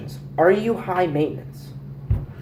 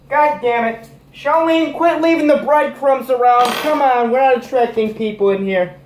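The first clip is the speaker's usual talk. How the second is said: high, raised-voice telling-off; exasperated scolding; 9 semitones higher, 4 dB louder than usual. Acted. Scolding Charlene over breadcrumbs, exasperated high yelling. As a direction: yell at someone across the room.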